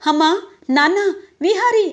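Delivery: loud, high calls rising name by name; calling out. Acted. Mother calling the family after the accident, loud and high. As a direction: call the family urgently from the house. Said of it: "అని పిలుస్తుంది".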